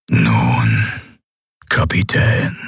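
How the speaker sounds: low, deliberate villain voice; menacing armored emperor. A narrator's reading. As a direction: address the captive with cold menace. Emperor addresses his captive.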